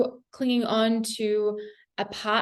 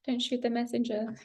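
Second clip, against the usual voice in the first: giggling through the words; laughing apology; 6 dB quieter than usual. Real, not acted. Reader laughing at her blunt tarot message. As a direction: laugh it off, softer. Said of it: "laughing apology".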